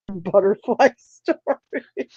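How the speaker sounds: imitating Scott, slowly and laughing; slow mocking drawl. Slow laughing imitation as Scott answers the muffin story request. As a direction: drag the words out slowly, half laughing. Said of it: "And then Scott's like".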